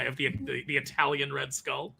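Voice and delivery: normal talking voice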